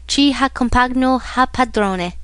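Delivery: Italian words 'Chi ha compagno ha padrone.' said slowly with Italian sounds; slow Italian saying; Italian proverb. Acted. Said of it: "you know the Italian proverb".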